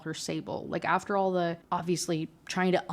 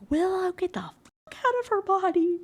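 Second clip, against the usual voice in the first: very high, strained mock yell; mock outburst; 12 semitones higher than usual. Boyfriend ordering the ghost out of her body, mock yelling. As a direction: dramatize a desperate order in a high voice.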